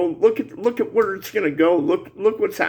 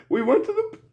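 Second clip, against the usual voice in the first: real tearful voice, higher pitched, breaking off; crying; 4 semitones higher than usual. Real, not acted. Man lamenting the line of no return, crying. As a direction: choke out the words through tears, halting. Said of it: "I'm crying like a bitch".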